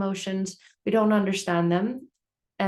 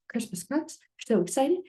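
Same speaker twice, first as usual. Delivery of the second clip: normal talking voice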